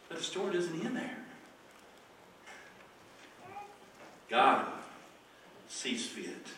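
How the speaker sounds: very soft, low pulpit storytelling; hushed preaching. Acted. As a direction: let each phrase hang in long pauses.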